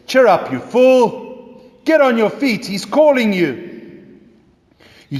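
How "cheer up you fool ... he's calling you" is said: high, loud mocking bystanders; mocking crowd. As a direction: sneer at him while passing on the call. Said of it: "Those around you say".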